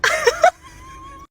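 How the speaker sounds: helpless high-pitched cackle; high squealing laugh. Real, not acted. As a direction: lose it completely, squealing. Breaks up mid-story.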